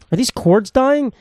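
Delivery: high, slow worried question; a touch of mock panic about dying cords; worried wondering. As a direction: puzzle over it in mock alarm. Worried about his phone cords.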